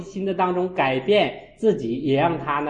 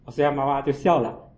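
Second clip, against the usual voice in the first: normal talking voice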